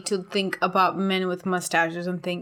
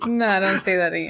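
laughing. Real, not acted. Laughing protest.

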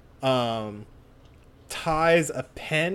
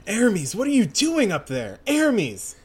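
high, raised urgent call; 8 semitones higher, 4 dB louder than usual. Acted. Heroine calls to friend up there, urgent calling. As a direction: call up to someone out of sight.

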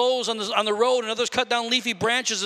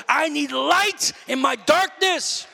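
crying out; 6 semitones higher, slower than usual. Real, not acted. Preacher cries out a plea.

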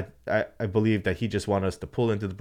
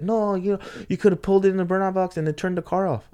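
high-pitched mock rebuttal; argumentative retort; 11 semitones higher, 3 dB louder than usual. Track official blaming the driver, argumentative, imitated. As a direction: argue back in a raised pitch. Acts out official's side of quarrel.

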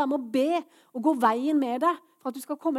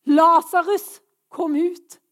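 loud, higher-pitched call, first word strongest; commanding shout; 5 semitones higher, 11 dB louder, much slower than usual. Acted. Preacher voicing Jesus calling the dead man out, commanding shout. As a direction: call out with full authority. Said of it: "så roper han".

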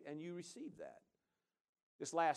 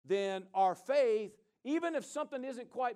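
preaching emphasis; 9 semitones higher, 8 dB louder than usual. Acted.

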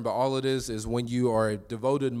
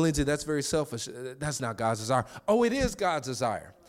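normal talking voice

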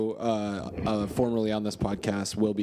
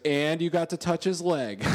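higher, laughing mock fiancee; teasing through laughter; 7 semitones higher than usual. Fiancee teasing about the leg, laughing. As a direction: deliver the punchline as she would, giggling.

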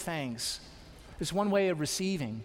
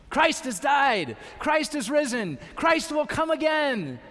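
higher, louder, triumphant proclamation; proclaimed loud; 7 semitones higher, 5 dB louder than usual. Shown as a demonstration. Preacher showing the congregation how to proclaim it, shouting. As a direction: ring it out like a shout of victory. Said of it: "Say it like that when you say it".

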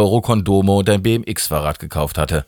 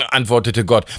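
plain reading voice

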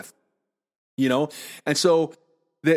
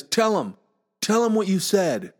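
higher-pitched, lightly voiced tattling kid; insistent seven-year-old; 6 semitones higher than usual. Seven-year-old pointing at his brother, insistent retold demand. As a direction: urge it like an indignant big brother.

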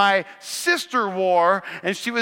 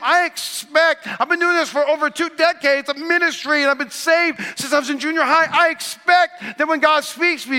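raised, driving sermon voice; preaching; 6 semitones higher, 5 dB louder than usual. Acted. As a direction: preach it with rising conviction. Preaching builds in force.

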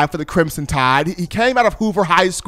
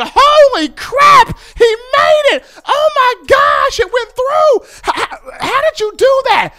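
much higher, shouted, slow cry of disbelief; stadium erupting; 3 dB louder, slower than usual. Retold or imitated. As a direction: scream it like the kick just went through. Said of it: "They're going".

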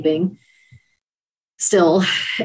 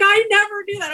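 high, moved sister's voice, lightly voiced; a hint of the sister welling up; teary sister; 14 semitones higher, faster than usual. Sister's tearful reply, retold.

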